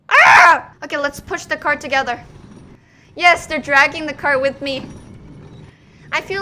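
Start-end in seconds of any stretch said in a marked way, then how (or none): 0.1-0.7 loud, high "aah"; sudden scream. Real, not acted. Player leaving with gnomes, sudden scream. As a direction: burst out with a sharp cry. Cry while freeing gnomes.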